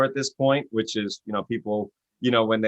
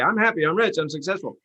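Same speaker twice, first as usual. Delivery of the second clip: mock chirpy affirmation; 6 semitones higher than usual. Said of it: "So you say".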